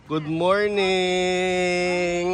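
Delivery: sing-song slow good morning; drawn-out greeting. Acted. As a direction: stretch it out very slowly.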